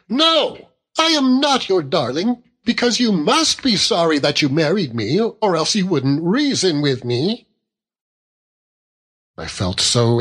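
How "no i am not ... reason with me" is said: put-on high-pitched petulant young wife; childlike wife, wounded. A narrator's reading. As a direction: protest like a hurt, spoilt child.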